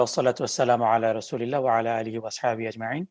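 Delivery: ordinary delivery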